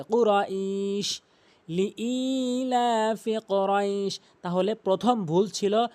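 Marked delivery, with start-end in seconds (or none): 0.0-4.2 কুরাইশ with ই held one alif at the stop; slow careful recitation; stretched pause form. Shown as a demonstration. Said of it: "থামলে কুরাইশ এক আলিফ টেনে".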